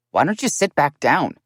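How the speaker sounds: Joe interrupts the family, firm; Joe cutting in. A narrator's reading. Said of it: "Joe's voice broke in".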